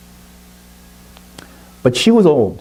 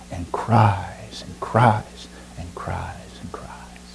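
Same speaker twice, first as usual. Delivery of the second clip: drawn-out whisper; 8 dB quieter, much slower than usual. A narrator's reading. Boy weeps after failing.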